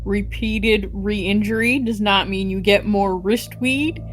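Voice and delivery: ordinary talk, nothing marked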